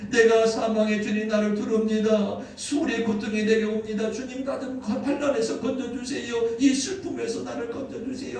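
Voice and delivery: high, loud cry to the Lord; imitated pleading prayer. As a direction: act out David's desperate plea for rescue. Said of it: "눈물로 기도했을 것입니다". (부르짖어 간구하는 기도 흉내)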